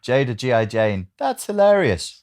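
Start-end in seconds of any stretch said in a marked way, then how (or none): 1.2-2.2 high, slow, amused; meme voice. Pretend. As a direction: voice the meme with glee. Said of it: "I should voice memes more".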